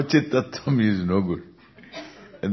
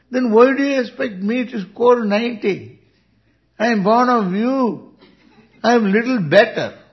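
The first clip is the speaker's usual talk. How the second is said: lightly voiced cheeky kid, higher and laughing; cheeky child talking back; 9 semitones higher, 4 dB louder than usual. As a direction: play the kid sassing his dad, getting higher and laughing. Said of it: "the child talks back".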